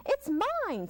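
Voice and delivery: high squeal on mine; squealing vole. Acted. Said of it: "squealed the vole".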